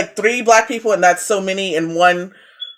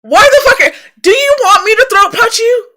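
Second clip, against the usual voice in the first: much higher, louder indignant snap; outraged retort; 11 semitones higher, 6 dB louder than usual. Retold or imitated. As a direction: go off on them, high and loud. Her outraged retort to neighbours.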